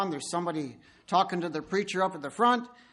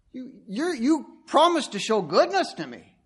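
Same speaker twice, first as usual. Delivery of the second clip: bride protesting, louder; upset bride; 5 semitones higher, 6 dB louder than usual. Acted. Upset bride confronting the groom at the altar, complaining. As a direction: complain at the groom, hurt and indignant. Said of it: "She's really upset".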